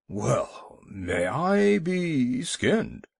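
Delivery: put-on slow, drawn-out old walrus grumble in an odd voice; grumpy old walrus. Sleepy old walrus answering the young seal, slow grumpy bluster.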